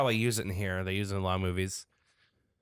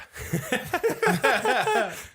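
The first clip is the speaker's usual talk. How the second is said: high squealing laugh; high burst of laughter; 5 dB louder than usual. Real, not acted.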